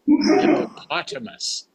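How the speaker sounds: hippopotamus whispered high with a laugh. Shown as a demonstration. Grammarian repeating the correct word, playful whisper. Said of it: "where it's hippopotamus".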